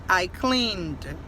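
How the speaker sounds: CLEANED low, one syllable ending in d; correct pronunciation. Shown as a demonstration. Teacher repeating the fix, cleaned low and correct in one syllable. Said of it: "não é D".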